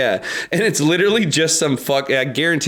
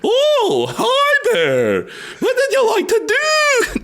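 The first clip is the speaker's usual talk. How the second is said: Mad Hatter voice; much slower than usual. Acted. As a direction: shriek it like a mad host. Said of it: "voice actor".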